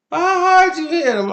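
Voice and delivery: high, loud mock mother's challenge; accusing mom. Mother confronts boyfriend for hitting son, mock outrage.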